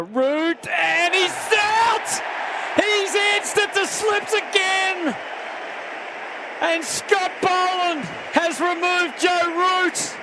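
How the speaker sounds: loud, high, thrilled shout; excited wicket call. Real, not acted. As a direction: roar the wicket over the crowd. Root dismissed, live call.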